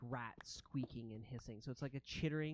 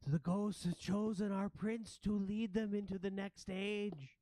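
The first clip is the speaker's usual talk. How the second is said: higher, proclaiming rat voice; ratling; 6 semitones higher, 4 dB louder than usual. Acted. Another ratling on the chosen prince, higher proclaiming voice. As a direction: announce a prophecy in a raised creature voice. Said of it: "one of the other ratlings said".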